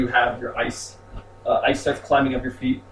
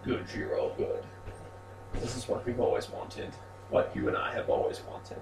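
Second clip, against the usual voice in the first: eerie whisper; 2 semitones lower, 9 dB quieter than usual. Acted. Pale woman whispers praise to Jiro after his killing spree, eerie whisper. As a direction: breathe it like a ghostly seductress. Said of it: "you hear this".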